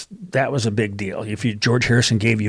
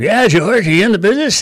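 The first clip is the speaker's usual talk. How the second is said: higher, louder mocking imitation of a famous singer; 7 semitones higher, 6 dB louder than usual. [name] teasing [name], parroting the question higher and louder. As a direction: tease him in a raised, louder copycat voice. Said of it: "[name] was doing his [name] impression".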